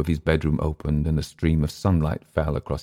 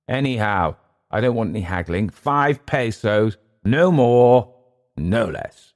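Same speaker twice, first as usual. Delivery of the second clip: higher, emphatic haggler's refusal; testy recluse; 5 semitones higher, 3 dB louder, slower than usual. Acted. Clift refusing to haggle over five pesos, testy. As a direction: lay down the price, slow on the figure. Clift sets his price.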